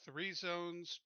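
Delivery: ordinary delivery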